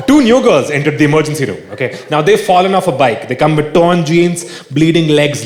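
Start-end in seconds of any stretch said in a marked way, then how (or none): none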